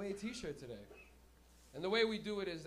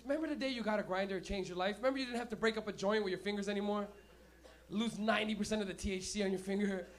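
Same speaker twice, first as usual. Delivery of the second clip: ordinary delivery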